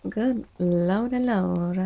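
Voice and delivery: ordinary delivery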